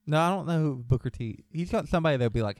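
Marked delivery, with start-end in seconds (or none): none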